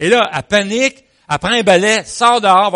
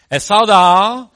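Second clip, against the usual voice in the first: ordinary delivery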